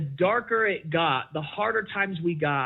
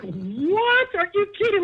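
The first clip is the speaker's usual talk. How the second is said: much higher, stunned disbelief; can't believe what he's reading; incredulous; 3 dB louder than usual. Retold or imitated. Reacting to the out-of-blue text.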